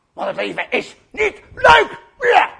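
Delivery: slow, loud word-by-word sulk; hammed-up grouchy complaint; grumpy. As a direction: spit out each word, then a high bleat. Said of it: "maar dan heel zagrijnig".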